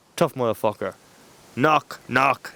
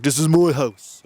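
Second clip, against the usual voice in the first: put-on broad Dublin accent, slow and raised; big Dublin accent; 5 semitones higher, 4 dB louder, slower than usual. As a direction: put on a thick Dublin accent, dragging the vowels. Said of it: "in a big Dublin accent".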